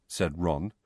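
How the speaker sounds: plain reading voice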